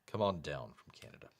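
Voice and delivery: soft, low, quick repeat of the call; quiet echo. Pretend. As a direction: echo the announcer's call under your breath. Echoing game-show call, trailing away.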